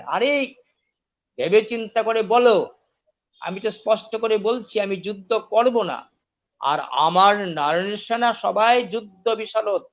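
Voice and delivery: deliberate emphatic urging. Acted. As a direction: press each point home firmly. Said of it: "আরো স্পষ্ট করে বললেন".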